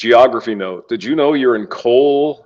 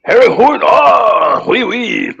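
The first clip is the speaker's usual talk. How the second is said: mock French accent; 9 semitones higher, 3 dB louder, much slower than usual.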